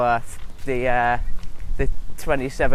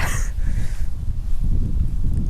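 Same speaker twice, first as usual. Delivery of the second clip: laugh. Real, not acted. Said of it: "I just nearly spat everywhere".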